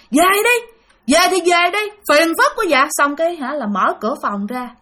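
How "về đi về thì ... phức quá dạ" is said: higher-pitched, irritated mock yell; retold yell. Cousin inside her room tells visitor to leave, mock irritated yell. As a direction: call out crossly to send someone away. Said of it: "ở trong đó la lên".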